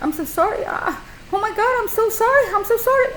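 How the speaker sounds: gushing panicked apologies, voice climbing; frantic apology. Retold or imitated. To the customer she hit with the lamp, frantic high apologies. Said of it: "and I was apologetic".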